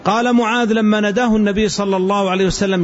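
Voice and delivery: usual speaking voice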